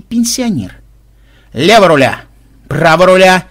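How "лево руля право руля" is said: loud helmsman-style commands; shouted orders. A narrator's reading. Leader steering the running players through turns, in loud commands. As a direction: call out each turn forcefully.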